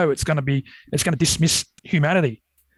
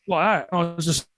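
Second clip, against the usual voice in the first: ordinary delivery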